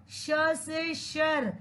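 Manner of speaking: श से शर very slowly, each word held; proper pronunciation. Shown as a demonstration. Teacher drills children on the letter, श से शर slow and careful. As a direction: stretch श से शर out as a model. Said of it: "pronounce properly dear children".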